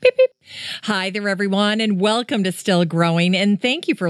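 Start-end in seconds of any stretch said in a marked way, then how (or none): 0.0-0.3 very high chirpy beep beep; beep sound effect. Shown as a demonstration. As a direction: squeak it like a blooper buzzer. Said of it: "Here's the blooper sound effect".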